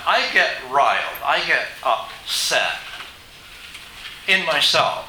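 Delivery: normal talking voice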